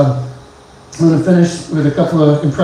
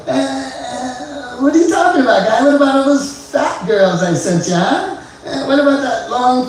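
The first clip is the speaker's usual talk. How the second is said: high, nasal-free wry Norm voice, laughing through it; high mocking Norm impression; Norm Macdonald impression; 9 semitones higher, 3 dB quieter than usual. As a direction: tease the guy like Norm. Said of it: "an impression of my favorite comedian".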